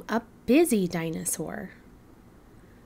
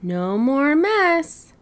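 firm pleased; 6 semitones higher, 5 dB louder, slower than usual. Acted. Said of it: "she said".

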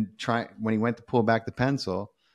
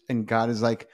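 ordinary talk

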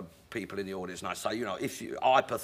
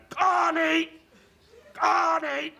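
very high, loud bird-like shriek of a name; a mynah bird calling the wife; parrot squawk; 6 dB louder, much slower than usual. Retold or imitated. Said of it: "this minor bird has heard him yelling".

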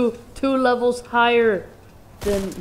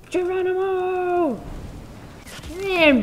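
playful battle cry; 9 semitones higher, slower than usual. Real, not acted. Jumping off in game.

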